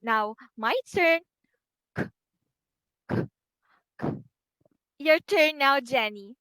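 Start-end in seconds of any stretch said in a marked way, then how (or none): 2.0-4.1 K as a crisp, voiceless k burst; k sound demo. Shown as a demonstration. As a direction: make the K sound as a short, sharp k. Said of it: "[name] will make the k sound three times".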